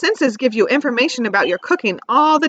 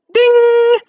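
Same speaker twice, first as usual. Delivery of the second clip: high, loud bell-like ding; sing-song timer chime; bright ding; 12 semitones higher, 6 dB louder than usual. Acted. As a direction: ring it out like a timer. Voicing oven timer bell.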